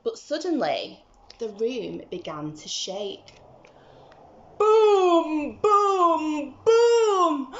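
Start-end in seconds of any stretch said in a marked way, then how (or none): 4.6-7.6 loud, high, drawn-out booms; booming footsteps. Acted. The giant's footsteps shake the room, booming loud. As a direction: thunder each boom like a giant stepping.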